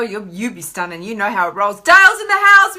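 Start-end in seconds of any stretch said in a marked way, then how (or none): 1.8-2.8 loud high welcome shout; hyped greeting to a regular; calling out. Real, not acted. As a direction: shout his arrival like a host. Greeting a viewer.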